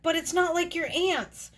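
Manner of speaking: high-pitched mock whine of objection; retold protest.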